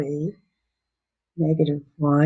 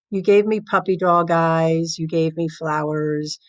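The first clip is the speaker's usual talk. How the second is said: ordinary talk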